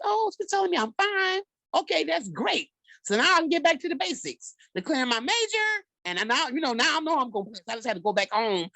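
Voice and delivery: put-on high-pitched, oblivious young student; naive nineteen-year-old. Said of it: "So my nineteen-year-old brain".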